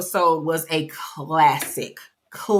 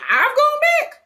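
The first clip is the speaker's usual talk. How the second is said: mock outraged disbelief; 5 dB louder than usual. [name] offended disbelief.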